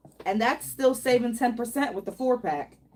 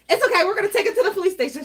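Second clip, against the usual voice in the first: mock threat; 10 semitones higher, 7 dB louder than usual. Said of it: "I was like".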